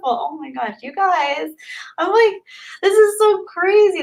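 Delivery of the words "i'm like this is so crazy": much higher, excited squeal; can't believe it, real delight; high giddy disbelief; overwhelmed squeal. Real, not acted. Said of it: "little personal freak out moment".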